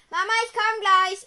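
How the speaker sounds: high, loud call to another room. Real, not acted. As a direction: shout toward another room. Calling to mother in another room.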